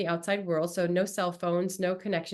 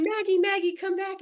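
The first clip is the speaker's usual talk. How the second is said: retold call after a runaway dog, much higher; husband retold calling a runaway dog, high-pitched and loud; 12 semitones higher, 4 dB louder than usual. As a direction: call the dog back across the stream.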